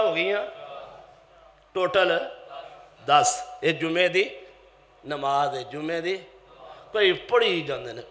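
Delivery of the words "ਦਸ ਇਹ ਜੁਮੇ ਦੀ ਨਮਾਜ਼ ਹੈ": ordinary delivery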